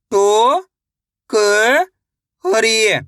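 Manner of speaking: अ, नो, खा drawn out separately, the first two rising; syllable by syllable. Shown as a demonstration. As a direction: sound out अ, नो, खा with long gaps. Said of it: "एक अक्षर का उच्चारण सही से". (अ, नो, खा अलग-अलग खींचकर)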